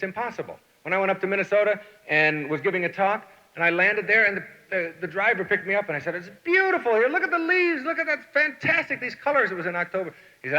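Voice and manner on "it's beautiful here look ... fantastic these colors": much higher, excited wonder; delighted. Retold or imitated. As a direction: gush over the autumn colors. Admiring autumn leaves.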